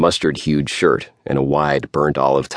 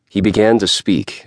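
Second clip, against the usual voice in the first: ordinary delivery, nothing marked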